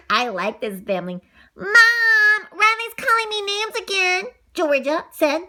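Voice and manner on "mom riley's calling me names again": loud, high tattletale cry for Mom; tattling call. Acted. Little sister tells Mom on her brother, loud whine.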